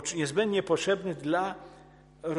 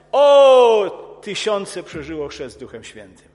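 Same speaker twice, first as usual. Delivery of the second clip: excited exclaiming; 15 dB louder, slower than usual. Retold or imitated. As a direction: mimic a crowd's thrilled outburst. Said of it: "I ci ludzie powtarzają".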